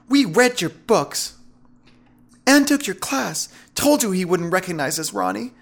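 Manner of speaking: high, hostile feminine voice; pointed high-voiced taunt; taunting women captors. A narrator's reading.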